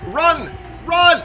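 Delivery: high, loud yell, building; panicky-high urgent call; urgent shout. Real, not acted. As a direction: shout a warning at full pitch. Calling out during tense game.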